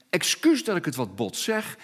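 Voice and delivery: normal talking voice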